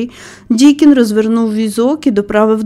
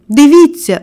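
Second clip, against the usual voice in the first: higher, louder command; exclamation; 5 semitones higher, 5 dB louder than usual. A narrator's reading. Boy showing the gardener he stands, exclaiming. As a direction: cry out for attention. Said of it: "вигукнув він".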